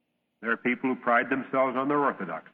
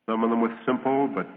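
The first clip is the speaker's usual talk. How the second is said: ordinary delivery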